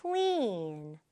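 "clean" with a long, spread-lipped ee. Shown as a demonstration. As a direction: stretch the vowel of "clean" sideways. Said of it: "입을 길게 이 양옆으로".

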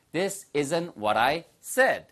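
very slow, clear model sentence; slow teacher demo. Shown as a demonstration. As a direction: say it slowly so they can copy. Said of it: "뭘까요?".

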